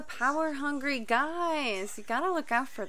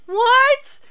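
shocked; 12 semitones higher, 11 dB louder than usual. Real, not acted. Reacting to time jump.

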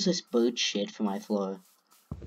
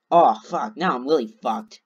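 ordinary talk

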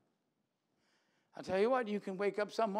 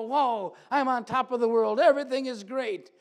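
louder, hammed-up on-top-of-the-world cheer; mock elation; 3 semitones higher, 9 dB louder than usual. Preacher imitates an emotional yo-yo on a good day, loud mock cheer. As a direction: belt it out like a happy-go-lucky fan.

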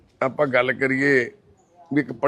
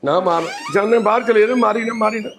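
pleading not to be hit; 8 semitones higher than usual. Real, not acted. Woman threatens to hit him.

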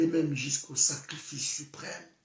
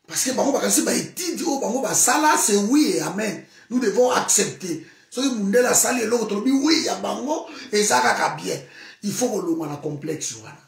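heated shouting rant; 4 semitones higher, 7 dB louder than usual. Real, not acted. Heated rant against colonial complex.

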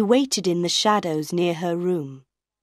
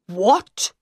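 sharp louder outraged what; shouted; 4 dB louder than usual. Acted. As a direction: bark it in disbelief. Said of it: "Monsieur Armand shouted".

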